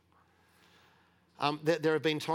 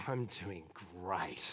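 quiet contentment; 7 semitones lower, 9 dB quieter, slower than usual. Retold or imitated. Moved man answers after transformation.

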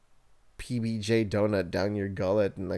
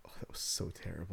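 whisper; 3 semitones lower, 14 dB quieter than usual. Real, not acted. Reacting to bad movie.

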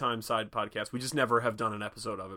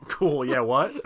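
louder, playing a baffled listener; mock bewilderment; 7 dB louder, slower than usual. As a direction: act politely lost by what you heard. Retold baffled listener in Snatch.

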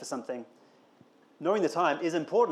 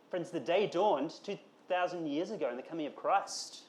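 usual speaking voice

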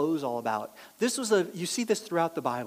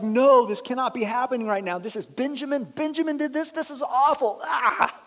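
louder, higher panicked lament; distraught; 7 semitones higher, 5 dB louder than usual. Retold or imitated. Brothers finding the cup in Benjamin's sack, louder panicked lament. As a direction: cry out as it all falls apart. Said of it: "they're so upset".

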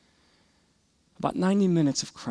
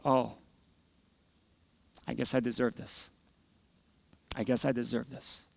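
put-on resigned child's thought; 2 semitones lower, 9 dB quieter than usual. Beaten fourth grader to himself, quiet resignation. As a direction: think it aloud, quietly giving in.

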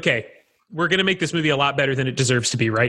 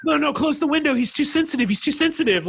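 high fussy voice; 10 semitones higher than usual. Retold or imitated. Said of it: "they're like".

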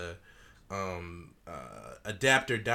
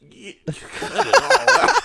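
very high, loud laughing voice; high loud laughing line; laughing squeal; 9 dB louder, slower than usual. Real, not acted.

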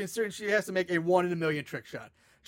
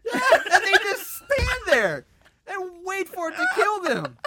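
higher, laughing through the words; laughing disbelief; 9 semitones higher than usual. Real, not acted. Friend ranting about the movie, laughing. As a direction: crack up while complaining.

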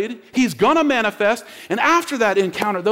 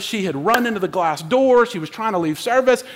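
usual speaking voice

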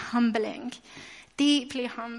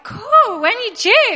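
much higher and louder, thrilled; excited squeal; 9 semitones higher, 8 dB louder, slower than usual. Retold or imitated. Mum meeting a stranger who's expecting, excited high squeal. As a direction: burst out with delight.

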